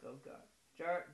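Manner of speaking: ordinary talk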